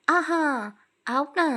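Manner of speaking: high, loud mocking aha; husband mockingly calling her bluff; mock surprise. A narrator's reading. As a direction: catch her out with a laugh in the voice.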